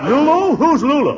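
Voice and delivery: high loud startled denial. Acted. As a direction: jump like you've been caught. Startled at his secret being out.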